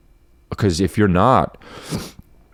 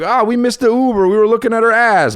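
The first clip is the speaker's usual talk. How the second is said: mock dumb bro voice; 12 semitones higher, 4 dB louder than usual. Mocking dumb social media skit.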